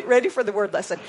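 ordinary delivery

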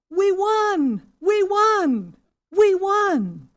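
high, very loud victory cheer; excited group shout. Acted. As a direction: shout it like a whole crowd winning together. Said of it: "excitedly shouted together".

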